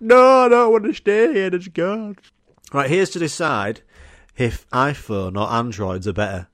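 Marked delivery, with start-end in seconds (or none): 0.0-2.2 put-on loud, high whining kid; protesting child. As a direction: wail it like a stroppy kid.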